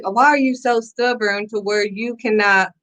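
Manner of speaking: ordinary delivery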